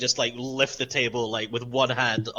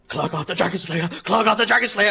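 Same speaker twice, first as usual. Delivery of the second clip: eager boast building higher and louder; excited Klargard; 7 semitones higher, 4 dB louder than usual. Acted. Warrior's eyes light up at a dragon, excited. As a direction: crow your title with growing excitement. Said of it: "his eyes will, like, light up".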